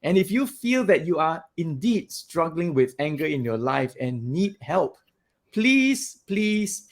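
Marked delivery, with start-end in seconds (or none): none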